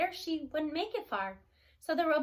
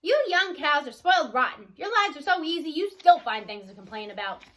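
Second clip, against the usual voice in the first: loud, huffy telling-off; scolding old cow; 8 dB louder than usual. A narrator's reading. Old cow scolding the young cows, louder and huffy. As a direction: scold the spoiled young ones. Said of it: "Old Annabelle snorted".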